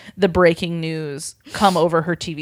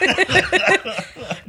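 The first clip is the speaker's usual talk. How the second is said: laughing at her own reading, high; 6 semitones higher than usual. Real, not acted. Narrator cracking up after voicing the groan. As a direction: break into a laugh.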